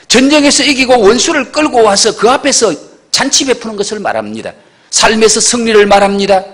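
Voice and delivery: sermon at full force; forceful preaching. Acted. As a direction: preach it to the congregation. Preacher pounding the point.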